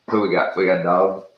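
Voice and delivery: loud excited call to the dogs; calling out loudly. Real, not acted. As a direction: call out over the barking. Dogs arrive, he calls out.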